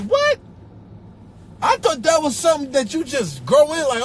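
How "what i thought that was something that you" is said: incredulous squeak. Acted. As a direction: squeak it out in shock. Outraged disbelief.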